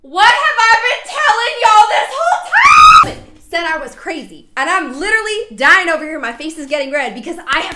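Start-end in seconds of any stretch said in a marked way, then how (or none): 0.0-3.4 real triumphant yell, much higher and louder; slow, vindicated shout; excited shout. Real, not acted. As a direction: burst out, finally proven right. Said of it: "I am getting so excited and emotional".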